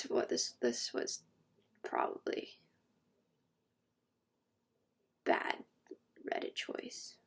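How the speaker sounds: trailing unvoiced whisper. Real, not acted. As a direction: mutter it under your breath. Embarrassed aside.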